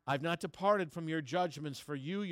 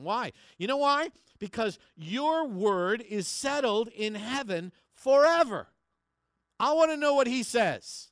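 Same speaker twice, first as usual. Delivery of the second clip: much louder, higher, proclaiming it to the church; fervent preaching shout; 9 semitones higher, 13 dB louder than usual. Real, not acted. Pastor proclaiming God's word to the congregation, loud fervent preaching. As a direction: preach it out at full force, rising high. Preacher building to full force.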